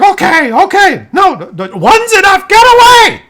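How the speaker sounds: put-on bickering old couple shouting; elderly parents yelling. Old parents shouting about hearing aids, high strained voices.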